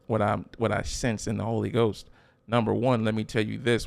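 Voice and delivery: ordinary delivery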